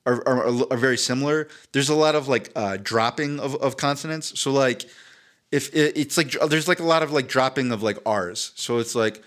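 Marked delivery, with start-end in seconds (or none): none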